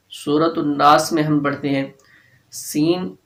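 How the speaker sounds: ordinary delivery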